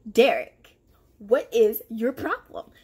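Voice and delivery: loud, slow confronting demand; confrontational demand. Retold or imitated. Cornering Derek in the break room, loud and demanding. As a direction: call him out hard.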